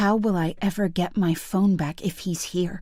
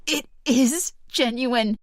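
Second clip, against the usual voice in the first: tearful insistence; 5 semitones higher, slower than usual. Acted. Insisting, near tears.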